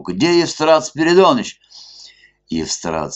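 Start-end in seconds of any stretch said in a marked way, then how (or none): 0.0-1.6 high loud call across the hall. A narrator's reading. Club guests calling for the police chief, loud. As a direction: shout the name to the far rooms.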